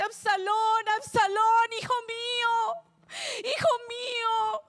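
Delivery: high, loud mock-weeping wail; lamento lloroso actuado. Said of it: "Comienza a llorar y dice". (lamento actuado de padre, agudo y lloroso)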